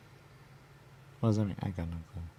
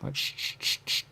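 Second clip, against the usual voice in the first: radio static. Retold or imitated. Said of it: "when you squawk, you squawk".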